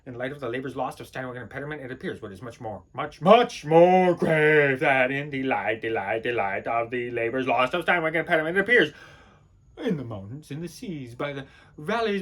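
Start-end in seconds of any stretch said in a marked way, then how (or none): 2.9-8.6 ponderous declaiming. Acted. Declaiming on the labors lost, ponderous and low. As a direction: stretch each word out with weight. Acted monologue, ponderous emphasis.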